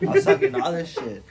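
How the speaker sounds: high, loud, laughing burst; cracking up at his own trash talk. Real, not acted. Laughing loudly as he tells friends about his drunk bravado.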